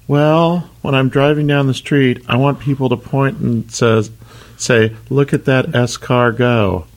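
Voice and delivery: high snail voice, slowing to the punchline. Acted.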